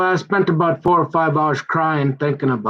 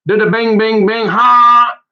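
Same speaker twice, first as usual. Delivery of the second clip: loud, high "bing, bing, bing, ha!" like a taser firing; mock taser sound effect, loud and high; imitated zap noise; 8 semitones higher, 8 dB louder than usual. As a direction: belt out the zap sounds, then a sharp "ha!".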